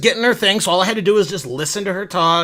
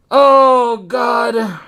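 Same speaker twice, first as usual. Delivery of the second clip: mortified groan; 2 semitones higher, 4 dB louder than usual. Real, not acted. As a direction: groan at an embarrassing memory. Groans at the memory.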